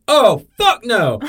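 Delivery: hammed-up furious principal; mock outraged yell. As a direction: roar it like you're done. Voicing principal's outburst.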